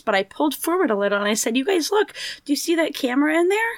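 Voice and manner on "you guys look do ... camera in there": put-on higher-pitched, quick question to children; lightly re-enacted babysitter drawing kids' attention; pointing out to kids. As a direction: get the kids to look, voice lifted. Speaker re-enacts talking to the children.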